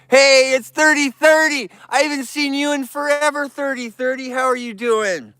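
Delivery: high, bright delighted hello; excited greeting. Real, not acted. Greeting an old TikTok supporter, high and delighted.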